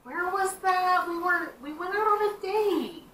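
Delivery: high, playful in-character voice. Acted. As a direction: tease him about the date. Player in character, flirting.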